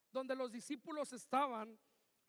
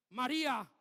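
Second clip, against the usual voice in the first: exclaimed name; 8 dB louder than usual. Retold or imitated. Jesus calling Mary at the tomb, loud exclamation. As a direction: call her name out strongly. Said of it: "le dijo con signos de admiración".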